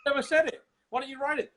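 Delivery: loud, high comic indignation; exasperated mock squawk; high-pitched protest. Acted argument with Schwarzenegger.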